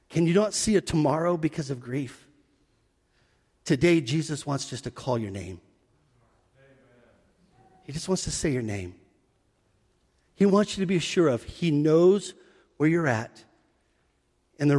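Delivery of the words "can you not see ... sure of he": softer, lower pastoral appeal; tender appeal. Real, not acted. Pastor gently asking grieving listeners, tender and soft. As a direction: lean in and speak gently to them.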